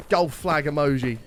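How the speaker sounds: usual speaking voice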